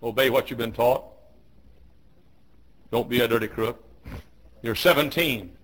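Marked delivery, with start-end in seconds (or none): none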